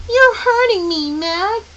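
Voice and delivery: sharp. Acted. Boy tells Meg she is hurting him, sharply. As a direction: snap the words at his sister in pain. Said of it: "Charles said sharply".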